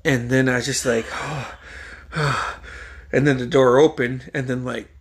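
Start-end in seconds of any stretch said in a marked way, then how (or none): 1.1-3.1 long, soft spent exhales; drained sighing, acted; imitated heavy sigh. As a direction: let the breath out, worn out. Retold collapse after the rant.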